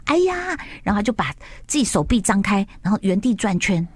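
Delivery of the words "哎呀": mock dismay. Minister Mingzhu feigns dismay.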